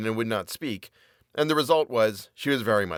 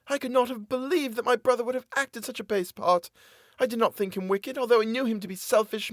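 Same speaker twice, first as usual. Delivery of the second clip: much higher, desperate woman begging; pleading captive woman; 12 semitones higher than usual. A narrator's reading. Captive woman pleading with her jailer for mercy. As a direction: beg for mercy in a high woman's voice.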